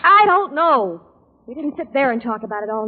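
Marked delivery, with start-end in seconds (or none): 0.0-1.0 loud, high, fed-up shout; angry outburst. Acted. Secretary shouting at pushy investigator, exasperated. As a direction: snap back at the questioning. Heated interrogation, she snaps back.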